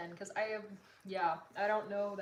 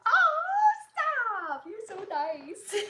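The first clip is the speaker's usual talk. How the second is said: flattered squeal; 12 semitones higher, 14 dB louder, much slower than usual. Real, not acted. Flattered by a chat compliment.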